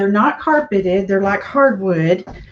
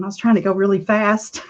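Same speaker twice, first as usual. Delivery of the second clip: ordinary talk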